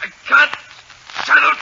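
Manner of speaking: high, frantic begging; desperate pleading. Acted. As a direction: plead for your life. Villain begs under the light.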